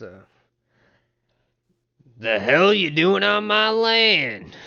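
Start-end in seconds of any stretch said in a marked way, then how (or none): 2.2-4.6 louder, higher, slow angry farmer challenge; gruff farmer. Acted. Farmer confronting strangers on his land, angry and loud. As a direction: bark at the trespassers.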